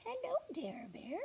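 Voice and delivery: high, bright little mouse greeting; tiny mouse saying hello; cheery mouse. Acted. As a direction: squeak a friendly hello up high.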